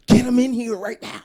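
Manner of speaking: high, loud commanding boss voice; urgent manager. Retold or imitated. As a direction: demand it right now. Manager demanding.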